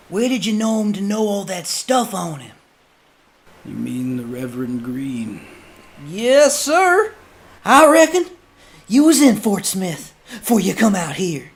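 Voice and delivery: men questioning the judge; rough questioner. Acted. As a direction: press him with a rough voice.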